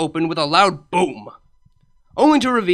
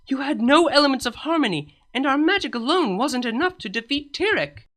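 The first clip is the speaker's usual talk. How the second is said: a slightly higher, composed royal voice; lightly voiced princess; 9 semitones higher than usual. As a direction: play her as a calm, earnest princess. Character voice for Celestia.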